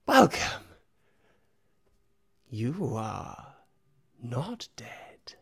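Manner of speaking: high, loud rasping creature voice; gloating rasp, unsettling. Acted. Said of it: "a rasping voice".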